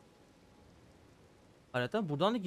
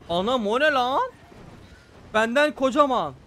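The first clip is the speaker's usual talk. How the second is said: shocked; 7 semitones higher, 7 dB louder than usual. Real, not acted. Huge enemy appears.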